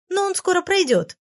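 high, quick reassurance from the fairy; hasty reassurance. A narrator's reading. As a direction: rush to reassure. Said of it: "поспешила заверить она". (высоко и торопливо заверяет)

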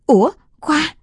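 high startled cry of a name; surprised. Acted. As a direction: suddenly realize and call out. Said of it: "miệng há hốc".

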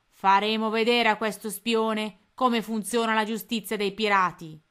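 horrible voice. A narrator's reading. Captain Codanera pleased with the captured spy, horrible gloating voice. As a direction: relish every word like a cruel captain. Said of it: "disse con voce orribile".

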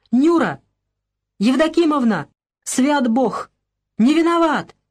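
the man begs Anna to spare him; desperate pleading. A narrator's reading. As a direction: plead for your life. Beaten man begs for mercy.

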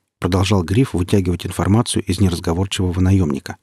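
ordinary delivery

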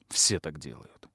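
low, soft grumble; a low sulky mumble; muttered. A narrator's reading. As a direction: mutter it sulkily under your breath. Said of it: "буркнул он".

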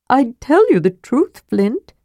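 sly kender explaining the way to the rune; Tass the kender. Acted.

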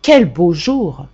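slow, mock-moved little exclamation; a boy rehearsing a sweetly emotional line, slowly; emotional murmur. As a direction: drag it out slowly with put-on emotion. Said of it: "en murmurant".

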